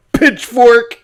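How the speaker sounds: loud, high mob cry; mock angry locals. Imitating offended Dominicans going for weapons, mock shout. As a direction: shout it as a call to arms. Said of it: "They're like".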